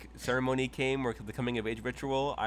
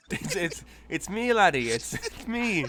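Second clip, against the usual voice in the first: higher, loud hammed-up Irish brogue; mock Irish accent; 8 semitones higher, 7 dB louder than usual. Player teasing the table in a loud mock Irish accent. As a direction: ham up an Irish accent, shouting. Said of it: "Why'd you go Irish for a second".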